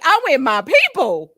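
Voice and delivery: loud, happy excitement; excited to be among her people. Real, not acted. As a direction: beam with joy. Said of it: "I'm excited".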